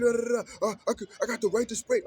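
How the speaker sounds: high, loud imitated yell; mock shout. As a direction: yell the refusal like the stubborn roommate. Roommate refusing, heated argument.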